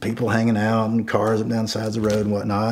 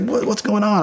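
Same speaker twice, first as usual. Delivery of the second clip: put-on confused girlfriend; 10 semitones higher than usual.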